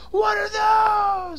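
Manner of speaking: very high, drawn-out mock shriek; exaggerated high-pitched meme shout; movie scream. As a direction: yell the meme line in a long screech. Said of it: "and she screams".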